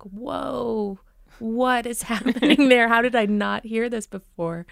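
amused, mock-astonished; retold astonishment. Coach recalling calling mom after moving away, mock astonishment. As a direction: react as if stunned, with a laugh creeping in. Retold shock at mom's accent.

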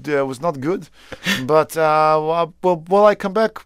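hesitant, brutally honest Dutch critic impression; blunt Dutch audience member. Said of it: "the Dutch are just like".

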